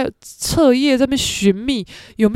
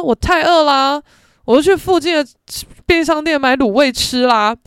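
louder, higher whiny protest; loud whine; 4 semitones higher, 5 dB louder than usual. Retold or imitated. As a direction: complain loudly that he was starving. Friend complaining loudly about hunger.

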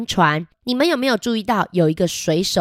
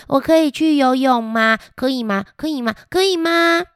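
put-on high, pleading little boy voice; 5 semitones higher than usual. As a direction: beg big sister like an eager child. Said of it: "跟姐姐说".

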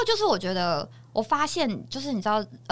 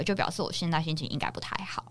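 whispering; 4 semitones lower, 8 dB quieter than usual. Real, not acted. Confiding aside.

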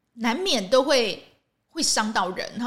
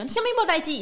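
a sharp, high mock retort; patient scolding; 10 semitones higher, much faster than usual. Patient scolding the nurse, a mock high retort. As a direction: snap it back like a cranky patient. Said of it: "病人怎么样骂他说".

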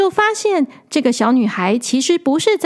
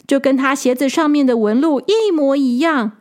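ordinary delivery

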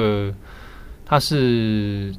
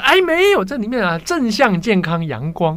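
high-pitched, hammed-up mainland accent reassurance; exaggerated mainland Chinese accent; 11 semitones higher, 4 dB louder than usual. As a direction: soothe her in a high mock mainland accent. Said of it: "你确定你是用这个口音跟人家讲话吗".

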